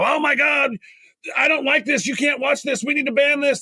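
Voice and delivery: hammed-up outrage, pitched high; mock outraged. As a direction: caricature the pearl-clutching side. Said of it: "they go".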